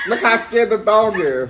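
cracking up while commenting; amused laughing. Real, not acted. Laughing at the dog clip.

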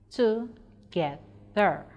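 "Together" slowly split into three separate syllables. Shown as a demonstration. Teacher showing three syllables in "Together", slow and separated. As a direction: break "Together" apart, pausing after each syllable. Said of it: "we have three syllables in that word".

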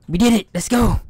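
high, loud victory cheer; excited cheer. Real, not acted. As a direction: celebrate like you just escaped. Escaped the monster, celebrating.

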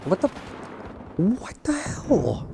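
high, slow breathy whisper; a stunned, airy what-the-hell; whispered disbelief. Real, not acted. Ambushed again.

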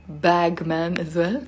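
normal talking voice

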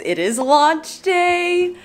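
high, drawn-out excited cheer; excited announcement. Real, not acted. Announcing launch day to viewers, excited. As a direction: stretch it out in a high, excited sing-out.

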